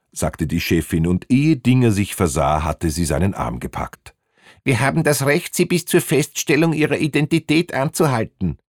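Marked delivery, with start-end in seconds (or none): 4.6-8.6 high, insistent official voice; put-on bossy official; officious female inspector. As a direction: assert your authority in a high-pitched voice. Female boss insists on her authority.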